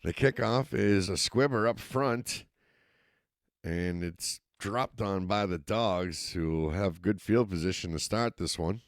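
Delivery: steady live football call, rising with the action; play-by-play commentary. Acted. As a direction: call the game as it happens. Play-by-play resumes.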